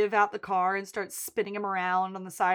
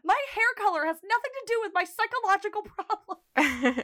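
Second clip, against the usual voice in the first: high, laughing mock indignation; laughing mock protest; 11 semitones higher than usual. Friends recapping a show, laughing mock indignation. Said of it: "It's very much like".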